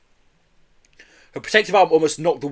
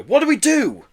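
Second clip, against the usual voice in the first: high, loud, helpless plea; groaning dismay; 13 semitones higher, 5 dB louder than usual. A narrator's reading. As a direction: despair over being locked out. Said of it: "groaned Joe".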